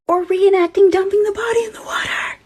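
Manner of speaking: hushed spooky whisper; eerie whisper. Acted. Storyteller whispering the scary choice to viewers.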